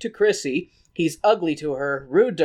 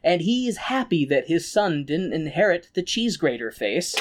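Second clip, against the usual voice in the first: normal talking voice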